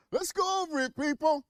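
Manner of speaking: high, loud call to the audience; rally the viewers like a hype man; rallying shout. Real, not acted. Host fires up the viewers to review results, high rallying shout.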